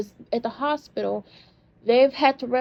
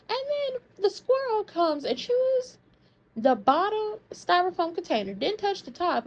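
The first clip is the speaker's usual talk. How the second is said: outraged storytelling; 8 semitones higher than usual. Real, not acted.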